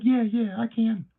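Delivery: put-on very high, loud squeaky kid voice; mock kid voice. Streamer imitating the boy agreeing to send his ID, high mock kid voice.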